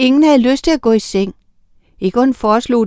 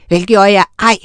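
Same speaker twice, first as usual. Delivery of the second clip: louder, a defiant shout; shouted denial; 6 dB louder than usual. A narrator's reading. Sofus shouting back at the accusation, defiant. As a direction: fling the denial back loudly. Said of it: "råbte Sofus".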